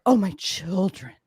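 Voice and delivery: mock alarm. Mimics everyone's defense.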